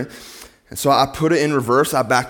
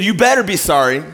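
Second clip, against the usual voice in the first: higher, louder mock snarl; angry retort; 7 semitones higher, 6 dB louder than usual. Irate driver snapping at the preacher, louder and higher. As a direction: spit it back like an irate driver. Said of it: "And he said".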